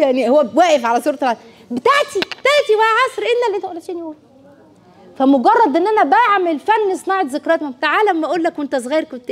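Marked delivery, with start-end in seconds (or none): 1.7-4.1 put-on child garbling a surah.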